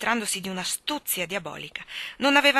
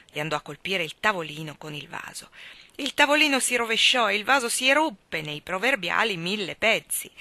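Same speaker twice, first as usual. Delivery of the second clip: usual speaking voice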